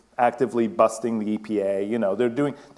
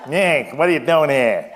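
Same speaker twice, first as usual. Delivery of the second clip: put-on loud, laughing Boston accent; 4 semitones higher, 8 dB louder than usual. Joking about his Boston roots, a Boston accent. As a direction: bark it out like a Boston local. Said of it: "Boston accent just sort of trying to creep out of me".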